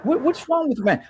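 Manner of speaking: high, mocking imitation of the movement's message. Mocking men who doubt the crying movement, high mock voice. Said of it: "And it was like".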